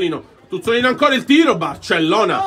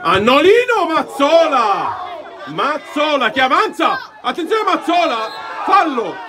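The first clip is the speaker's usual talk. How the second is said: frantic commentary; 9 semitones higher than usual. Real, not acted. Final seconds frenzy.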